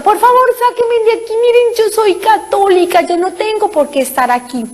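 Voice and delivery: high, pleading cry for help; desperate plea. Retold or imitated. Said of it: "yo grito".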